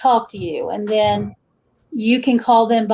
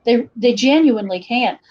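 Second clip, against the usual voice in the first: ordinary talk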